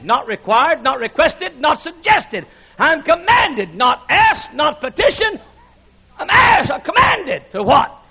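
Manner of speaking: high, shouted sermon climax; preaching at full force. Acted. Preacher shouting scripture to the congregation, fiery. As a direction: shout it to the rafters like a revival preacher.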